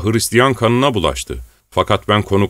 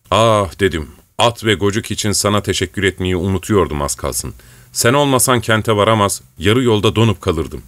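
ordinary delivery

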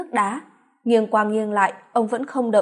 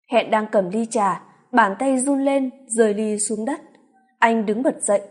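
ordinary delivery, nothing marked

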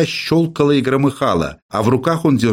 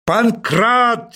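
high, panicked yell for the servant; hysterical shout; 10 semitones higher than usual. Acted. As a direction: scream the name, losing it. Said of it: "истерически закричал Персиков".

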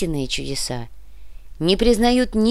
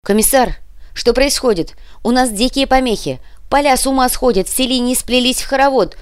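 high frantic shout, louder and louder; desperate shouting over radio; 7 semitones higher, 5 dB louder than usual. A narrator's reading. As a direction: yell an urgent warning down the radio. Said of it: "Рация отчаянно надрывалась".

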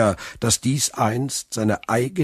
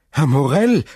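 much higher and louder, calling out; excited shout; 6 semitones higher, 6 dB louder than usual. A narrator's reading. Clerk bursts in calling Morel, thrilled. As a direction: call him with urgent joy. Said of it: "rief er".

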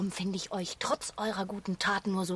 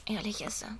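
guilty whisper; 10 dB quieter than usual. Acted. Regret after the quarrel.

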